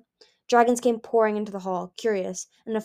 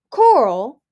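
loud, higher shocked outcry; appalled cry; 5 semitones higher, 13 dB louder than usual. Acted. Gil hearing of the executions, crying out appalled. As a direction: cry her name in horror.

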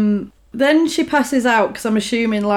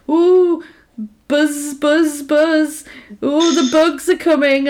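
mocking bully taunt; 4 semitones higher, slower than usual. Retelling the mean girls mocking Jennifer, sing-song taunt. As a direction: tease her like a schoolyard bully. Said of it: "the girls start bullying her".

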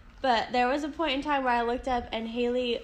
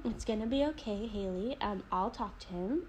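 low, very soft, unhurried reassurance; calm; 5 semitones lower, 11 dB quieter than usual. Retold or imitated. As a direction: soothe as a patient friend would. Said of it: "He's so calm".

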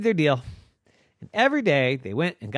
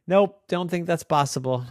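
plain reading voice

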